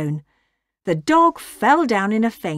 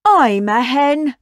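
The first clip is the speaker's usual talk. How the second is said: high, loud, slow boast; self-important cow, raised voice; proud; 5 semitones higher, 5 dB louder, slower than usual. A narrator's reading. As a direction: announce it proudly, stretching it out. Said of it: "said Bella proudly".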